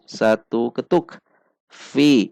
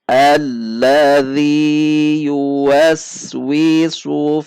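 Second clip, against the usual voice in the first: very slow melodic chanted tartil; Quran recitation; 4 semitones higher, 3 dB louder, much slower than usual. Shown as a demonstration. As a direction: chant each word with long pauses. Teacher recites verse once more.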